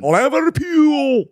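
drawn-out, high mock Liverpool accent on "Liverpool".

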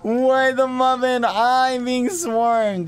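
high, loud delighted squealing; overwhelmed, high-pitched excitement; excited squeal. Real, not acted. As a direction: squeal as if mobbed by fans. Swarmed by bees in game.

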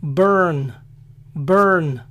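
"burn" as "brrn", no U sound; American "burn", R carrying the syllable; English vowelless R. Shown as a demonstration. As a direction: say "burn" as "bern". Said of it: "No se pronuncia la vocal U".

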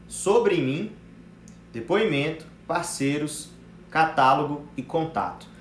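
spaced-out list, slow and deliberate; slow dictation. Real, not acted. Coach dictates profile highlights for note-takers, slow dictation. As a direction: dictate item by item, with pauses.

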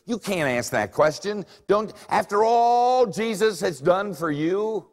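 high, loud preachy scolding; mock a sanctimonious believer wagging a finger; pious scold. Preacher mocking a religious person scolding Peter, high and loud. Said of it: "if you had been really, really religious".

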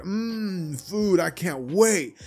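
high, slow, savoring mmm; hungry delight. Acted. Deku eyeing the dinner table, slow and high with greedy delight. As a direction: drool over the food, stretching every word. Deku excited about food.

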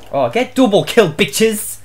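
normal talking voice

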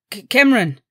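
loud urgent call with a stutter start. A narrator's reading. Doctor calls the alpha at his door, loud and urgent. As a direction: shout the name through the door. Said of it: "rief der Arzt".

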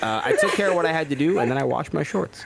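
ordinary talk

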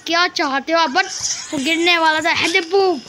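ordinary talk

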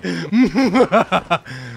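loud, hammed-up villain cackle; gloating evil laugh, played up; mock evil laugh. Villain laugh after the taunt.

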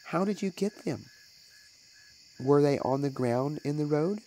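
high, urgent questioning mother; anxious high question. A narrator's reading. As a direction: press the child for the truth.

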